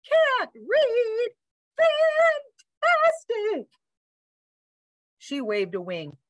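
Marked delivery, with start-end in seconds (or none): 0.1-3.7 very high, slow operatic bird; delighted bird teacher. A narrator's reading. Bird thrilled her new student can't read, high sing-song. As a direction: sing out each word high and long.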